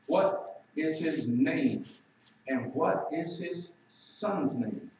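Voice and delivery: each word drawn out, slow and deliberate; read slowly. Shown as a demonstration. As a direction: linger over the question, slowly. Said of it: "I'm gonna read it slowly".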